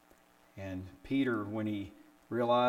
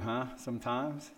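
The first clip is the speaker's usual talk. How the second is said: ordinary talk, nothing marked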